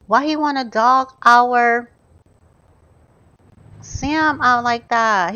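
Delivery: normal talking voice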